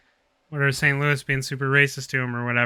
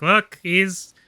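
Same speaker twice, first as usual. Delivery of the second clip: higher, louder gloating announcement; mock NHL boast; 6 semitones higher, 3 dB louder, slower than usual. Mocking the NHL, gloating boast. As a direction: crow it like a league promo. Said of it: "be like".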